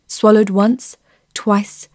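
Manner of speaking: ordinary delivery, nothing marked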